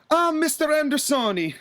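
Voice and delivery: mock Italian wrestler begging for a match; bad Italian accent. Booker retelling Santina pleading with Arn, in a hammed-up Italian accent. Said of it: "I can't do a good Santino".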